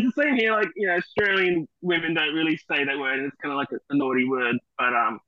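ordinary talk

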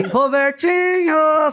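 loud, slow drawn-out call; embarrassed slow shout. Retold or imitated. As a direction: yell it reluctantly into the megaphone. (grito constrangido e arrastado)

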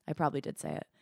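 ordinary delivery

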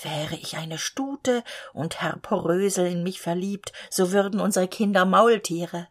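plain reading voice

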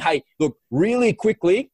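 high, slow, bright phone pitch; radio voice. Shown as a demonstration. Cold-calling a contact, a high radio-host pitch. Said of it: "my radio voice".

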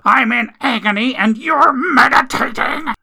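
high, loud pained outrage; injured man raging at his girlfriend; furious yell. Acted. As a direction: spit it at her through the pain. Injured Jack yells at her.

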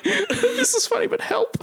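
high pleading voice. Retold or imitated. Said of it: "I'm like".

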